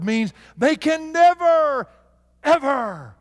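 never lifted high, loud and slow, then ever; stress on never, ever. Real, not acted. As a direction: hammer never and ever, stretching them out. Emphatic never ever separated.

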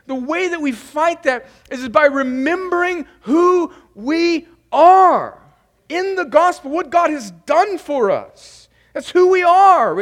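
high, loud, ringing pulpit voice; preaching at full force. Acted. Preaching climax on identity.

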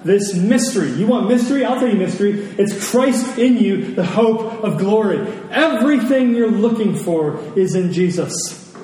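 ordinary delivery

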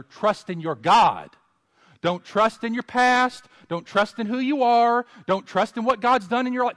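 high and loud pulpit exhortation; preaching at full force. Acted. Preacher urging the church to trust God, loud and high. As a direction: drive it home to the congregation.

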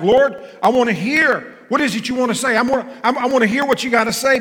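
high, loud, urgent plea; eager plea. Pretend. Preacher voicing a believer's eager plea to God. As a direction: beg to hear every word.

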